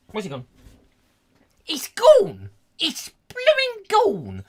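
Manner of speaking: high, loud wail of disbelief; mock outcry. Host aghast that the sound has vanished, mock outcry. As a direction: cry out in exaggerated dismay.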